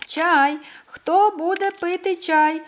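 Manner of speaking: loud, high call, drawn out slowly; a squirrel calling the animals up the hill for tea. A narrator's reading. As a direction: call everyone over for tea. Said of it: "скликала всі".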